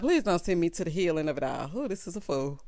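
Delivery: ordinary talk, nothing marked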